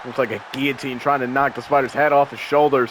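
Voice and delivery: ordinary talk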